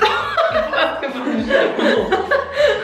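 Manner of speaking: high burst of laughter. Real, not acted. Friends laughing at the table.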